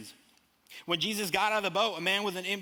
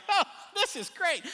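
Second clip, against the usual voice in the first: much higher, dry "oh, this is great"; mock cheer over a new disaster; sarcastic; 14 semitones higher, 4 dB louder than usual. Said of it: "they're like".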